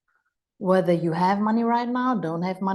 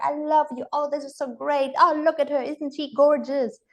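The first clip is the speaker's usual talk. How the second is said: higher, doting delight; gushing grandparent on stage, higher; gushing praise; 6 semitones higher than usual. Retold or imitated. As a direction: coo over the granddaughter on stage. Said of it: "says like".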